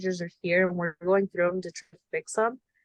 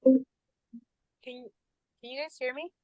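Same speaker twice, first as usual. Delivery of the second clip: echoing tunnel; 7 semitones higher than usual. Real, not acted. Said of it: "You sound like you're in a tunnel".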